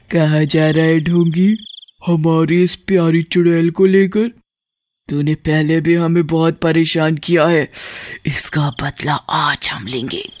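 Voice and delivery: lightly voiced menacing witch queen; witchy threat, slowing at the end. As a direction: confront with a touch of regal menace. Witch queen confronts the tantrik.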